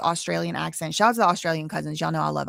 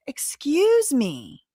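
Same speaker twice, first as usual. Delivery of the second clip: high, drawn-out indignant retort; mock indignation; 7 semitones higher, much slower than usual. Commentator answers back to the rude man, indignant. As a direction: stretch it out, affronted.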